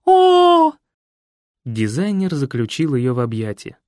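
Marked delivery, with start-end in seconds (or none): 0.0-0.7 high loud cry of delight; ecstatic shriek. A narrator's reading. Nikoletta shrieking as she hugs Fred. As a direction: swoon with a squeal. Throws herself at him.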